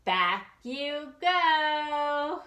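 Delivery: slow, drawn-out back you go; slow refrain. Acted. Narrator sending Deanie back to the tub, slow. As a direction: stretch each word.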